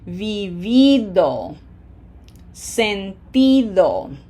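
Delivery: vivido, sentido, slowly, each I of -ido drawn out; long emphasised I in vivido and sentido; stress on the I. Shown as a demonstration. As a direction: lean hard on vi-VI-do, sen-TI-do. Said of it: "the I of I D O".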